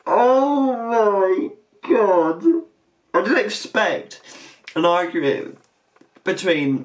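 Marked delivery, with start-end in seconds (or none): 0.0-2.4 slow, strangled laughing gasp; laugh-crying. Real, not acted. After the pig and rabbit insult match, slow laugh-cry. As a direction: stretch it out, breathless with laughter. Reactor cracking up at argument.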